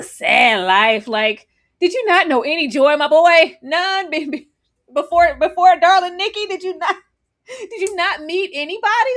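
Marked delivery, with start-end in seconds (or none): 1.8-9.1 higher-pitched, hammed-up disbelief; mock incredulous questioning. Fan mock-questioning her boy about his joyless life, incredulous. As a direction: press him in playful, raised-pitch disbelief.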